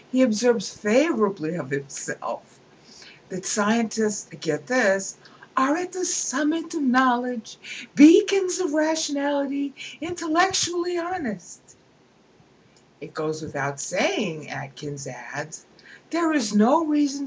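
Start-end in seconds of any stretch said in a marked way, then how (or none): 5.6-11.6 high, swelling mock grandeur; mocking pompous quote. Lecturer mocking Atkins's boast to students, high and pompous.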